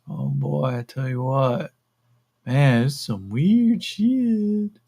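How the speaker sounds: high-pitched stoner drawl, stretching words; slow, dazed stoner voice; stoned curiosity. Acted. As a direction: play a baked guy marvelling, pitch swooping up. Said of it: "like, the same stoned curiosity".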